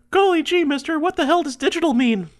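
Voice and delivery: put-on very high, loud gee-whiz kid; wholesome 1950s kid. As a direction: ask it like an old-timey child. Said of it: "What, little me?".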